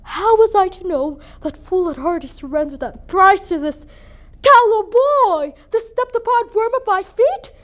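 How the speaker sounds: building to a high, loud outburst; scornful outrage. Acted. Mother scorns the callow boy at her feet, outraged. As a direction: spit contempt in a rising question.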